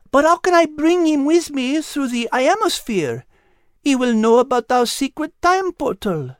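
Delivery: high, French-tinged voice, gently sad; a tender French lady cat grieving; French cat character. Acted. Liz worries.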